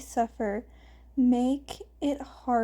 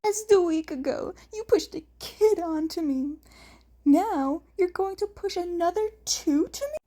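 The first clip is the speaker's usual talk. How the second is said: higher, louder teasing brother voice; 7 semitones higher, 3 dB louder than usual. A narrator's reading. Hisashi teases his brother about the kids, higher and playful. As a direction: rib him with a higher, playful lilt.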